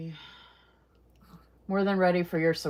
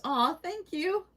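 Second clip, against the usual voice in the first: touched thanks; 11 semitones higher, slower than usual. Real, not acted. Viewer calls her the sweetest.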